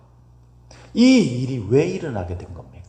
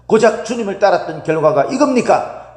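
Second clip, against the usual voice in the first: higher, indignant rhetorical challenge to the Lord; mock indignant complaint; 6 semitones higher, 3 dB louder than usual. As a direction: play the believer arguing with God, mildly heated. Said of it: "막 따지고".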